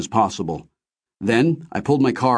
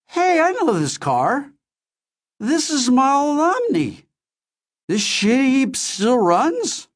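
higher, slow, dazed dad; woozy father voice; slower than usual. Acted. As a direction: play the drugged father, drifting. Drugged father waking up.

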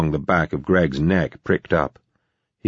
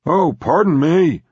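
thick sarcasm; 12 semitones higher, 4 dB louder than usual. Acted. Philip to the man guarding his sources, heavily sarcastic. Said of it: "shoveled on the sarcasm, thick and dripping".

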